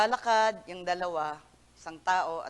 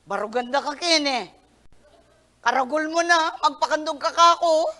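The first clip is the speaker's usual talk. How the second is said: scolding parent; 6 semitones higher, 8 dB louder than usual. Pretend. Father scolding grown child.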